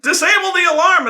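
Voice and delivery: high, loud urgent order; imitated yelling. Game master as the group yelling at the Decker, imitated yelling. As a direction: yell it like a frantic teammate. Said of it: "they start yelling at the Decker".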